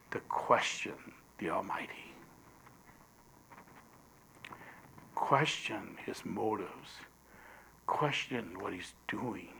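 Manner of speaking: a warning, whispered low; intense whisper. Acted. Preacher warning the congregation about doubt, in a low whisper. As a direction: whisper it low and hushed, pulling the room in.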